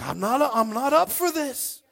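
loud, high mock whining rant; hammed-up indignant protest; protesting complaint. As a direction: balk loudly, as a fed-up disciple. Acted disciples protesting to Jesus.

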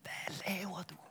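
whispered disbelief; shocked whisper. Acted. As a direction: hiss the question in alarm. Sailors confront Jonas.

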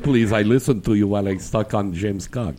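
badly faked Russian accent, imitating a guest; attempted Russian accent that slips toward Irish; mock Russian accent. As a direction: put on a dodgy Russian accent. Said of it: "he's trying to sound Russian".

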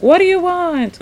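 hammed-up Wiz challenge; mock booming Wiz. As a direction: play the Wiz trying to intimidate. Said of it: "He's like".

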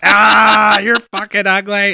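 high, loud taunting cry; mock jeering. Troll heckling woman on livestream, mock jeering. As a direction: imitate a heckler crowing an insult.

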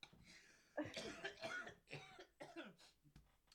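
real coughing fit; choking cough. Real, not acted. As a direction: choke and cough hard. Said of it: "sorry for me choking".

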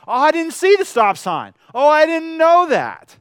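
high, loud mock-innocent protest; mock excuse. Preacher mimics sinners pleading ignorance, hammed-up innocent protest. Mocking excuses we cannot make.

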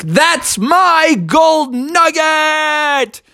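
slow drawn-out high shout. Acted. Bubby crawling out of the snow, shouting after the cat. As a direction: stretch out every word in a yell.

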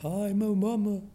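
high spooky ghost; high wailing ghost voice. Pretend. Playing ghost answering.

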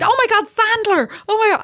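high-pitched mock teenage freak-out; excited fluster. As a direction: act out the son's flustered excitement. Son correcting his typo, retold.

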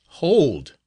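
loud, high cry of hold; sharp interruption. Acted. Novice stopping the friar's oath, loud cry. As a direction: cut him off sharply. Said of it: "interrupted the novice".

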